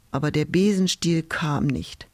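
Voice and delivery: usual speaking voice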